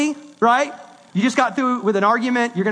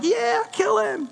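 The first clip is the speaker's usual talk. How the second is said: higher, slow callous approval; cold younger Paul voice; 11 semitones higher, slower than usual. Retold or imitated. Paul recalling Stephen's killing, slow callous higher tone. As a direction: voice Paul's former cruelty, slow and high. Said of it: "I was like".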